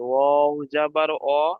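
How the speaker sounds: slow, chanted-out syllables on a level pitch; teacher spelling it out slowly; segmented spelling. Shown as a demonstration. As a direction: break it into pieces and stretch each vowel. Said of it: "কেটে কেটে বানান করতে হবে".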